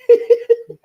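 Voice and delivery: high unvoiced squeal of laughter. Real, not acted. Laughing while mocking the attention seeker, high squeal. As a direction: burst into a high airy squeal.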